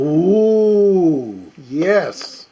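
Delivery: usual speaking voice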